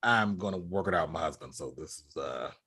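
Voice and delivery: low, soft, dismissive quote; imitated brush-off. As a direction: quietly imitate someone calling it over. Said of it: "Jax is pretty much like".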